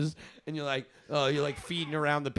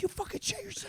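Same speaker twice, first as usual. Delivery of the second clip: very high, softer mock fury; mad friend; 7 dB quieter than usual. Brooke so mad in the car, high-pitched mock anger. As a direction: imitate her squeaky indignation. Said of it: "Brooke was so mad".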